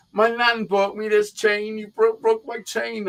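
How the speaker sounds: high, slow tearful whine, imitated; mock crying. Mocking the beaten man over his broken chain, tearful whine. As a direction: mimic a beaten bully blubbing. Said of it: "He starts crying to me".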